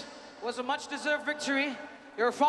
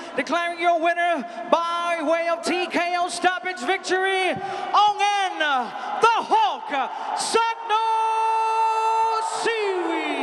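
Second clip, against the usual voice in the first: building to a booming winner call; ring announcer; 4 dB louder than usual. Real, not acted. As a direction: announce the winner, rising to a roar. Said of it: "penjelasan dari ring announcer".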